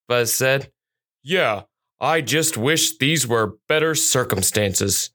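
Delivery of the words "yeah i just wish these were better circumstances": lightly voiced slow police dog; slow, a touch regretful dog voice; canine officer Ajax. A narrator's reading. Police dog to his fellow officers, slow and mildly regretful. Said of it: "Ajax said".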